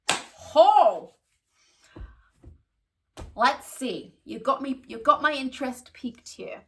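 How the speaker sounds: big, loud American "Ho!", then intrigued; booming American customer, put on for the story; American accent. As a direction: imitate a brash, impressed American client. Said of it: "in her American accent".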